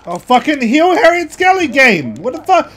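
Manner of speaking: frustrated yell. Real, not acted. Gamer yells at the game for not healing. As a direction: rant at the unfair game.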